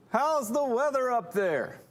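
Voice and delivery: slow, high holler; sustained high call to someone towering above; rabbi calling up. Acted. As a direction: stretch the question out in a very high calling voice. Rabbi calls up to the giant.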